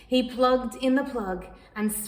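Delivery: ordinary delivery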